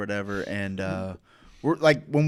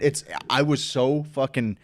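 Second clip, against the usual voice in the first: normal talking voice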